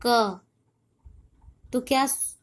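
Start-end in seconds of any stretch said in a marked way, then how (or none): 0.1-0.4 क said alone, ending on an audible अ; demonstrated pronunciation. Shown as a demonstration. Teacher showing pupils the vowel inside क, sounding it out. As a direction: sound out क firmly with its final अ. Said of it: "क उच्चारण कीजिए".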